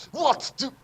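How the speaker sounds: ordinary delivery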